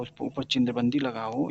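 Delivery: ordinary delivery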